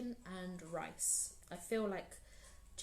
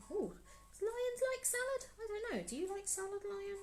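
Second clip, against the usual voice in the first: high, squeaky little voice for the lion; 12 semitones higher, faster than usual. Pretend. Asking the lion puppet in a squeaky voice. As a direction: pitch way up like a tiny toy lion.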